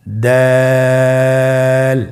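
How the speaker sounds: দাল with the vowel held three counts; Arabic letter with three alif lengthening; three alif stretch. Shown as a demonstration. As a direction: draw দাল out long and thin. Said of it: "আমার সাথে আবার বলুন".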